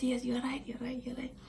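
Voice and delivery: hushed whisper to calm him. Retold or imitated. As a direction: whisper to settle him down fast. Whole place was looking at.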